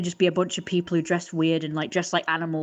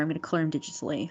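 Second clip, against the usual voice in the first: ordinary delivery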